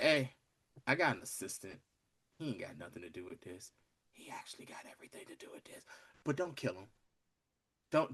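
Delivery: low, very soft, near whisper; hushed retold plea. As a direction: imitate a quiet, confidential plea to an assassin. Acts professor secretly pleading to assassin.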